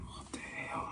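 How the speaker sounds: breathless whispered disbelief; shocked whisper. Retold or imitated. Reacting to the missing money in a stunned whisper. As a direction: hush it out, stunned.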